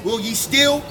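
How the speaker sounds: usual speaking voice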